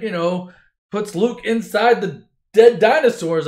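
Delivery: ordinary delivery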